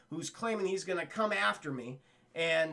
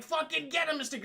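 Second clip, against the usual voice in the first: high urging shout; mock cheering shout; 8 semitones higher, faster than usual. J J cheering Mysterio on, high shout. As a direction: egg him on.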